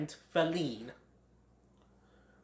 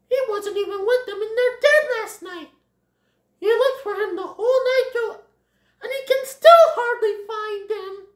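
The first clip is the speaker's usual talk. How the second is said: very high, loud little-sister voice; Thumper's worried sister; 6 dB louder than usual. Acted. Sister tells of the missing Bambi, high urgent voice. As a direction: report it urgently, pitched way up.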